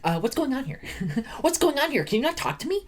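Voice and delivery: high-pitched mock actress voice; Anne Hathaway impression. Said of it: "this whole movie, she's like".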